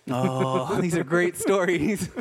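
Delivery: put-on awed kid voice. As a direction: play a dazzled boy hearing tall tales. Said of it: "And I'm a twelve-year-old going".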